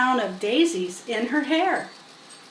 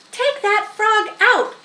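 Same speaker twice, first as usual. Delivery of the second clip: slow, high, loud command; ordering; 10 semitones higher, 5 dB louder than usual. A narrator's reading. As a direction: boss him around, each word firm. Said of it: "ordered Fern".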